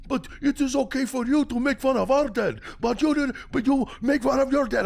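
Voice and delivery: high, loud mock Turkish accent, indignant; mock Turkish replier. Imitating angry Turkish replies, high loud mock accent. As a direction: shriek the complaint in broken English. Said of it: "bombarding me with fucking replies".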